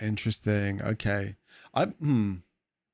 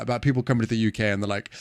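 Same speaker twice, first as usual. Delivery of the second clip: ordinary delivery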